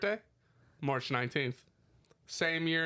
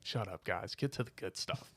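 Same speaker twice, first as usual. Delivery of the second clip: low, soft imitation of fed-up listeners; under-the-breath mock grumble; imitated listeners; 6 semitones lower, 7 dB quieter than usual. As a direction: drop low and quiet, mimicking an impatient audience. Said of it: "They're like".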